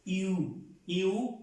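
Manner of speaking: "iu" said fast, the i barely there; quick iu for French U. Shown as a demonstration. Teacher shows students the French U sound. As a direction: slide from i into u quickly. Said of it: "como si dijéramos en verdad".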